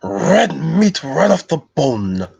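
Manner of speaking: slow, high, relishing dwarf voice; Gimli. Acted. Streamer as dwarf promising a feast, Gimli voice. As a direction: savour it slowly as a feasting dwarf. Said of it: "I can do my Gimli voice".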